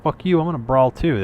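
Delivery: half-serious defiant outburst; mock brawl challenge. Player butts into the hall's brawl, mock defiant.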